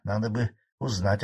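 ordinary delivery

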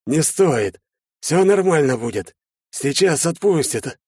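pained, struggling reassurance; strained protest. A narrator's reading. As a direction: insist while in pain. Stricken father waves off help.

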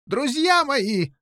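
high-pitched, drawn-out call; crying out. A narrator's reading. King calling from his cell, high cry. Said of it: "закричал из своей камеры король Пеленор".

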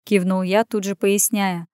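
usual speaking voice

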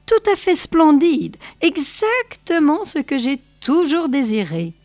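husky and moved, stressing tout; a choked, delighted murmur; hoarse with emotion. A narrator's reading. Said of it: "la voix rauque d'émotion". (voix rauque d'émotion)

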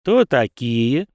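slow, lazy guard's demand; lordly question. A narrator's reading. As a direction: drag it out, bored and in charge. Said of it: "вальяжно распорядился тот".

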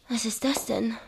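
puzzled whisper to herself; whispered puzzlement. Acted. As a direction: breathe the question, unsettled. Woman alone finds something odd.

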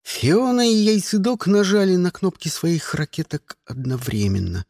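ordinary delivery, nothing marked

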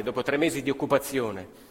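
ordinary delivery

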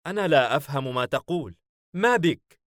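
mildly baffled, pitch jumping at the end; puzzled surprise. A narrator's reading. Demon baffled by his angry comrade, puzzled. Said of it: "حاييم باستغراب".

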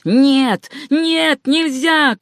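high, emphatic refusal; high protesting shout; crying out in protest. A narrator's reading. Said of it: "вскрикнули одни".

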